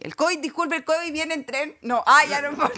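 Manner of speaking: high-pitched comic protest breaking into laughter; playful high-voiced complainer; mock objection. Joke protest about covid on trains.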